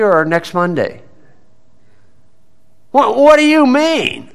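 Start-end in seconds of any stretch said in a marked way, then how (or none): 2.9-4.3 much higher, startled "what do you mean"; surprised confusion. Retold or imitated. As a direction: play the caught-off-guard defendant, voice jumping up. Acting confused defendant in court.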